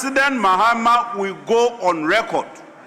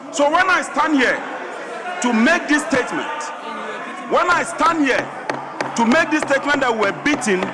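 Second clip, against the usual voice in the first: raised, forceful protest; impassioned shout; 6 semitones higher than usual. Real, not acted. MP shouting about being beaten.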